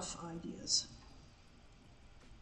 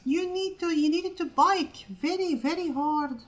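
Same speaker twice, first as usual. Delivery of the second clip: crank it up like a grumbling grandpa; hammed-up old geezer recalling hardship; grumpy old-timer; 10 semitones higher, 7 dB louder than usual. Continues old-timer rant.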